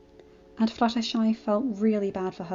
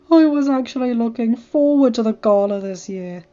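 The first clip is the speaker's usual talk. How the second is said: whiny; 7 dB louder than usual. Acted. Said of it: "Rainbow whined".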